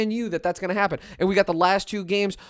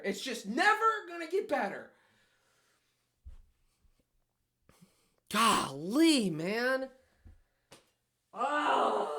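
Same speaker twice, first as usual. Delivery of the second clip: quiet despair; 3 semitones higher, 9 dB quieter, slower than usual. Real, not acted. Despair after the loss.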